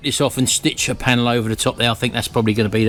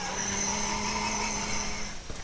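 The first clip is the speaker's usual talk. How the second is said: soft laugh; 7 semitones higher, 13 dB quieter than usual. Real, not acted. Laughing at baby.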